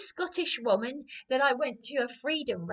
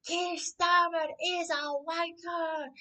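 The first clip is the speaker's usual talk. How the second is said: slow, drawn-out, higher mock chant; imitating a bellow; 8 semitones higher, slower than usual. Retelling a Scottish protester bellowing, mock chant. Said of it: "was bellowing".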